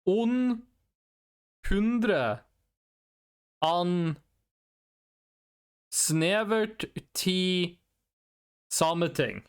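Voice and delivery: put-on slow Norwegian accent; Norwegian-only answer. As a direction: drag out the Norwegian words slowly. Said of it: "I can only use Norwegian words".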